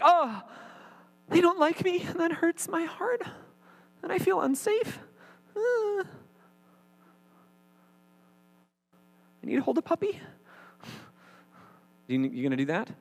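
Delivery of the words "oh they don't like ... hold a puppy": very high, whiny, hurt voice mocking a self-pitying victim. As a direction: squeak it out like a wounded, self-pitying crybaby. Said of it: "I'm mocking some of you right now".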